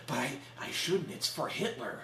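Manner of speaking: soft, hesitant underling impression; a meek protest, half under the breath; timid underling. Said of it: "And he's like".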